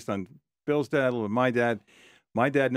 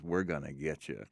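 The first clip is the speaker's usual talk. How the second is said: cigar ad pitchman; 6 semitones lower, 8 dB quieter than usual. Retold or imitated. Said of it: "And he goes".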